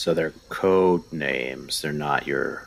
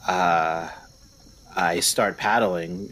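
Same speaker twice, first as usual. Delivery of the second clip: ordinary talk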